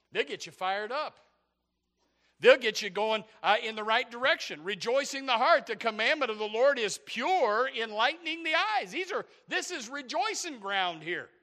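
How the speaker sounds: high, loud, fired-up proclamation; full-force preaching. Real, not acted. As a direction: declare the scripture with rousing pulpit force.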